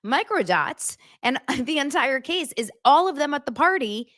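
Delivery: ordinary talk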